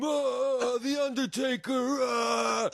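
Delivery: hams up the wrestlers' ring call; mock wrestling call. Nephews wrestling on the foyer floor, mock wrestling yell.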